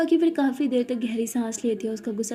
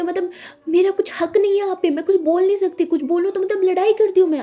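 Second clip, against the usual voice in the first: higher, louder angry protest; 7 semitones higher, 6 dB louder than usual. Acted. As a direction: push back hard. झगड़े में अनोखी चिल्लाती. (गुस्से में ऊँची आवाज़)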